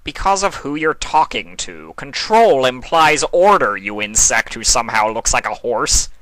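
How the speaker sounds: a god of chaos talking down; mild scorn. A narrator's reading.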